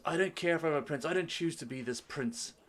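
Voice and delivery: defiant prince. Acted. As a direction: push back hotly as the young prince. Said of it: "He goes".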